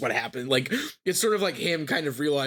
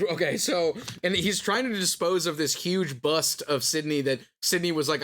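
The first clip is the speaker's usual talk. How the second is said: ordinary delivery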